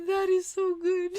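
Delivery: retelling the goalie's delighted laugh. Retold or imitated. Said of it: "He's like".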